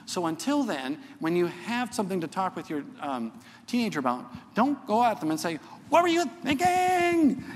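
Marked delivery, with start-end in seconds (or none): 5.9-7.4 high, loud exasperated "what were you thinking"; mock scolding parent. Pastor mocking a parent scolding the teen, high and loud.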